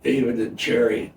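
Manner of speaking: ordinary talk, nothing marked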